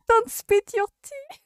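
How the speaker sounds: high squealing laugh through the words. Real, not acted. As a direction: crack up at the spit take. Laughing at the spit-take scene.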